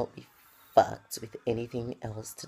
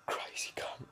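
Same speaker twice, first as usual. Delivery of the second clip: whispered in fake fear; hushed, mock-frightened aside; mock scared whisper; 9 dB quieter, slower than usual. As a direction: whisper it like he's dangerous. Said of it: "And I go".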